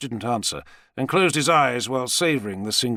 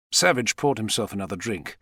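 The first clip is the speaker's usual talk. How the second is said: plain reading voice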